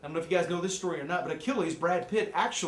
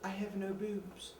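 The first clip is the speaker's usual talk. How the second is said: high dopey actress parody; mock Diane Kruger; 6 semitones higher, slower than usual. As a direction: play a vapid starlet, slowly. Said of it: "I'm Diane Kruger".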